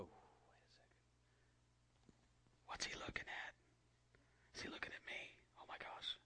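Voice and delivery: whispered worried students; students whispering. Shown as a demonstration. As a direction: whisper like nervous kids in class. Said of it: "do this".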